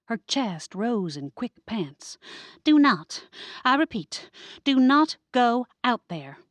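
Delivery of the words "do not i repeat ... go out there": higher, louder, breathless alarm; panicked warning. Acted. Amelia, out of breath, warning everyone not to go outside, higher and louder. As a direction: bar the door with your voice, frantic. Panting cousin warning from door.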